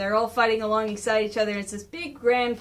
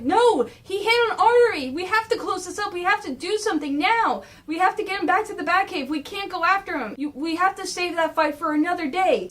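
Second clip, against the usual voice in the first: higher, pressing plea; urgent Leo; 6 semitones higher than usual. Retold or imitated. Leo stopping Batman from chasing, high urgent plea. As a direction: insist urgently, pitched up. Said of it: "Leo's going like".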